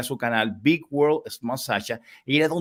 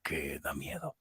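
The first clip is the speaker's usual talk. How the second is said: spooky whisper; 11 semitones lower, 13 dB quieter than usual. Pretend. Host whispering to Laura about a scary topic, spooky hush. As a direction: drop to a hushed, eerie whisper.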